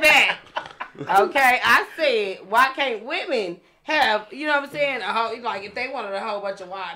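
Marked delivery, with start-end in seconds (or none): 1.2-4.3 slow, deliberate restatement; restating the question slowly for the group. Shown as a demonstration. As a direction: spell it out slowly, half laughing. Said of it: "let's run it back".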